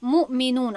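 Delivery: loud, clear مؤمنون with a falling close; carefully sounded word. Shown as a demonstration. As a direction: announce مؤمنون as the finished answer. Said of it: "چی شد؟".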